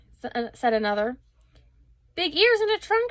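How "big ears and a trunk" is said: much higher, louder outraged shout; indignant shout. A narrator's reading. Rat shouting back at the crowd, high and outraged. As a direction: shriek it back in disbelief. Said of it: "shouted the rat".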